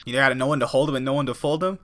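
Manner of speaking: quick joking whisper. Real, not acted. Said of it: "No, I'm just kidding".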